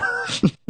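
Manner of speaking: a sudden very high squeal of laughter. Real, not acted. Laughing at his own joke about the Vikings, squealing. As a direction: burst into a shrill giggle.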